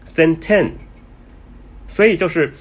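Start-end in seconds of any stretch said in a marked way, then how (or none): 0.0-0.7 Senten repeated alone, two even syllables ending in n; Thai-style Senten. Shown as a demonstration. Vlogger repeats the Thai pronunciation for viewers, Thai-style. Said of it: "它会念成Senten".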